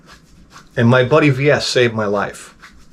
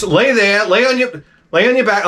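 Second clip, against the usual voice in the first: bossy wife; 7 semitones higher than usual. Retold or imitated. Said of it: "she goes".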